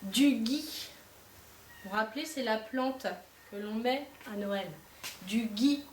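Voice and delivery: teacher dictating words one by one; word dictation. Shown as a demonstration. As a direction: dictate carefully to the class. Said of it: "vous allez m'écrire".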